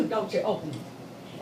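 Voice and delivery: low-key, slow warning not to look; menacing warning. Retold or imitated. Joe warns her about bag.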